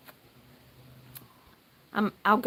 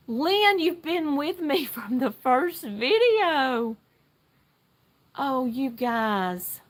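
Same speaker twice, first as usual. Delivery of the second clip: excited; 5 semitones higher, 3 dB louder, much slower than usual. Real, not acted. Learns a viewer's loyalty.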